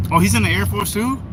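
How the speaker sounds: normal talking voice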